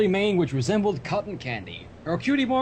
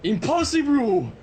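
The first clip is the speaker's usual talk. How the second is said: mock outrage; 6 semitones higher, 4 dB louder, slower than usual. Mock outrage at pinker pink.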